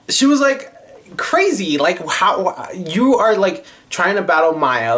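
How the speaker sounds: who would battle Maya; disbelieving outburst. Real, not acted. Amazed at the producer.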